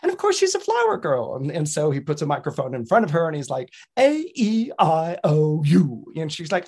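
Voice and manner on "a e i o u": "A E I O U" slow, each vowel pure and drawn out; correct vowels. Acted. Teacher modelling "A E I O U" for flower girl, precise. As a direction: intone "A E I O U" carefully like an elocution teacher. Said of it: "how to speak correctly".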